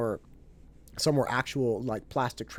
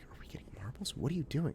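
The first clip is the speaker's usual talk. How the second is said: whisper; 3 semitones higher, 8 dB quieter, faster than usual. Retold or imitated. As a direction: whisper a confused question on the side. Wife whispering aside to him.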